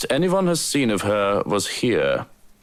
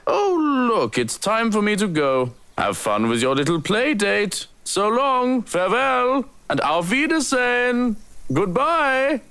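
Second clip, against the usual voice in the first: much higher, sing-song goodbye; mock cheerful farewell; 12 semitones higher than usual. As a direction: breezily slip out the door. Butler cheerfully escapes.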